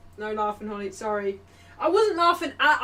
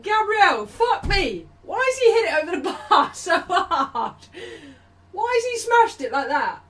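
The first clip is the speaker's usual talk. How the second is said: high, loud exclaiming with a laugh breaking in; exasperated disbelief; 7 semitones higher, 5 dB louder than usual. Real, not acted. Streamer reacting to Gabriel's miss, loud exasperated disbelief. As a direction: cry out in baffled exasperation, pitch way up.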